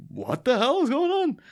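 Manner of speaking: very high mock-baffled cry; comically confused, pitch shooting up; acted bewilderment. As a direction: squeak it out in disbelief. Freeze thrown off by her lie.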